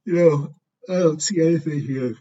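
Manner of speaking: bored yawn while reading charts; talking through a yawn. Real, not acted. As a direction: yawn right through the line. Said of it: "Excuse me, should be yawning".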